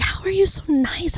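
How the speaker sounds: breathless whispered wonder; hushed amazed whisper; whispered awe. Retold or imitated. Repeated in whisper.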